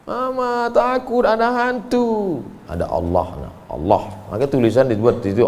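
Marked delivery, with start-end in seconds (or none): 0.1-2.4 put-on scared child. Child afraid of ghosts. 2.7-4.0 put-on calm a child to sleep; soothing mother. Mother soothes her frightened child at bedtime.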